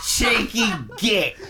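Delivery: slow, high, drawn-out "cheeky git"; laughing fake British accent; laughing mock cockney. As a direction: taunt like a stage Londoner.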